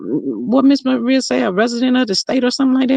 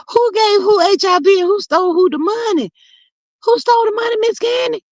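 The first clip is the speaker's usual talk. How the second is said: much higher, puzzled; confused surprise; 12 semitones higher than usual. Real, not acted. Asking a viewer who she means, puzzled.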